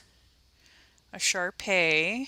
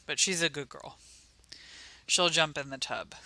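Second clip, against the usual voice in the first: ordinary talk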